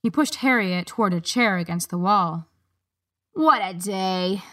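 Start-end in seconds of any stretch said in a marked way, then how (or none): 3.3-4.4 slow, weary groan of a bored guard. A narrator's reading. Desk guard groaning about his day, weary. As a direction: drag it out like a tired groan. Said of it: "groaned the guard with the pink nose".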